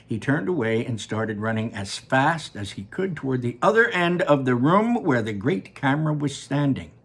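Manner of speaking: plain reading voice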